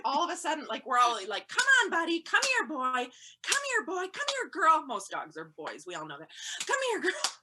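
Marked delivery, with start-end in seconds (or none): 1.5-4.9 high, loud dog-calling voice. Retold or imitated. Owners calling dogs out of woods. 6.7-7.3 very high coaxing call; calling the dog. Retold or imitated. Owners calling dogs out of woods.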